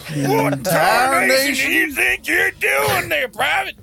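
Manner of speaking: very high, mock-shouted Western scolding; hammed-up Western officer. Game master playfully scolding a private, Western officer. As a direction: holler at a recruit like a cartoon cowboy officer.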